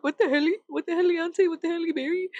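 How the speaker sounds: high, silly sing-song disbelief; mock astonishment. Everyone reacting to a reformed addict, mock astonished. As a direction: play up bafflement, pitched high.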